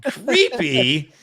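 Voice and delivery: a lightly voiced creepy drawl; mock creepy. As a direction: say it with a hint of a leer. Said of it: "She does creepy".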